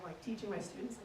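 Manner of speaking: usual speaking voice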